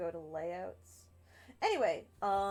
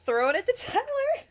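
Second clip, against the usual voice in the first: excited host call; 7 dB louder than usual. Real, not acted. Host calling the next player.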